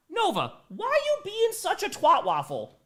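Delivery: high, slow pet scolding voice. Real, not acted. Owner scolding her cranky cat Nova, high and slow.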